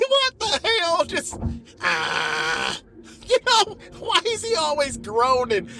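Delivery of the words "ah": deep groan. Pretend. Bert groaning.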